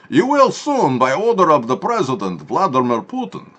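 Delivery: high, loud hammed-up Russian threat; mock Russian accent. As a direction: announce the takeover like a cartoon Russian.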